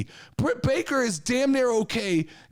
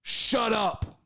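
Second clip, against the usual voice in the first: slow, deliberate dressing-down; telling off the locker room; slower than usual. Retold or imitated. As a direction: lay down the law to the wrestlers, slowly. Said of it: "I go".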